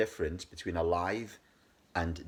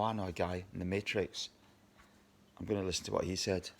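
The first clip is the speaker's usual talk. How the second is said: ordinary delivery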